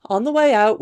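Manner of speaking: plain reading voice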